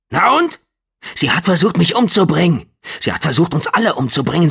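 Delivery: high, loud furious ranting; angry shout. Acted. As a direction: rant it, hammering each claim. John rages about the officer.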